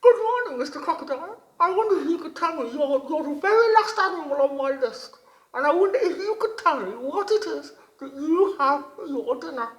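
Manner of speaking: high, loud, bright-eyed little frog voice; wide-mouthed frog voice. A narrator's reading. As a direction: squeak it out like an eager little frog. Frog greets the crocodile.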